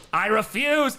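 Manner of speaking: loud, high outburst of refusal; emphatic refusal. Real, not acted. Repeats refusal, now emphatic.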